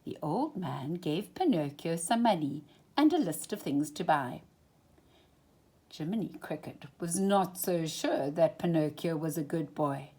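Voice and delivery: plain reading voice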